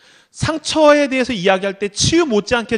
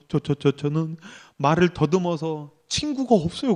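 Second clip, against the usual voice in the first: low, soft stammer, retelling a sobbing contestant; imitated tearful stutter; 6 semitones lower, 7 dB quieter than usual. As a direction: stutter the first word, mock-pitiful and subdued. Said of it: "막 울면서 말을 막 더듬". (울먹이며 말 더듬는 흉내)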